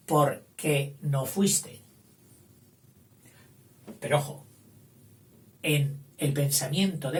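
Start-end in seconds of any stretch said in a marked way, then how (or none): 0.1-1.8 very slow, word by word; slow model sentence. Shown as a demonstration. Teacher reading the example sentence to the class, very slowly. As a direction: spell it out slowly for the students. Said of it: "sustituirle por un qué".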